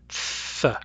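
Th as a soft voiceless hiss between the teeth; th sound demo. Shown as a demonstration. Teacher isolating theta for the class, voiceless hiss. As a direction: breathe the Th out past the tongue tip. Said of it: "it represents the th sound".

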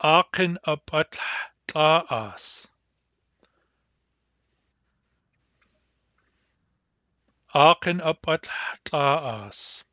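"Akin apat taas?" spoken slowly, each syllable distinct; slow model pronunciation. Shown as a demonstration. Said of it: "Another way to ask".

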